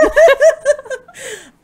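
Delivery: loud high cackle; high shrieking laugh. Real, not acted. Laughing fit.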